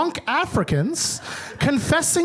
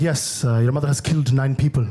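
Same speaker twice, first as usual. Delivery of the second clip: imitating uncle; 10 semitones lower than usual. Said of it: "It's like".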